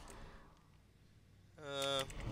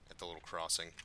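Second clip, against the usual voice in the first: ordinary talk, nothing marked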